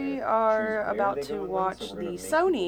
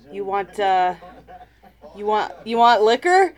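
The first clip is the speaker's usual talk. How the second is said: waiter voice; 9 dB louder than usual. Acted. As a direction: play the waiter asking, loud. Waiter voice in a joke.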